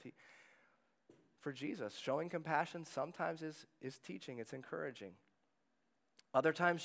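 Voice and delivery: usual speaking voice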